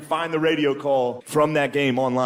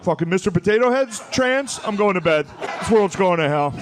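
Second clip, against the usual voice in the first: higher, indignant dad outburst; angry dad; 6 semitones higher than usual. Retold or imitated. Dad storming off to bed after watching Fox, mock angry. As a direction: imitate a dad fed up with the news. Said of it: "they get so angry they have to".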